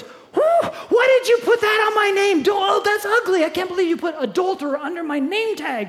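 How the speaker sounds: high, loud indignant outburst; Andy outraged. Acted. Andy shouting at Jesus over his name tag, outraged. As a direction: protest the label in shock.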